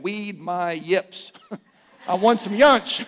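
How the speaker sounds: preacher voicing the boy, laughing; little boy voice. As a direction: play the toddler insisting, laughing as you go. Boy voiced holding grandma's face.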